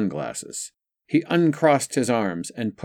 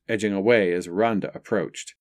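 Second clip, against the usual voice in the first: usual speaking voice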